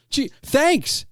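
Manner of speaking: high, mock grateful; a sarcastic gee thanks. Real, not acted. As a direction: toss it off with dry sarcasm. Sarcastic reply to compliment.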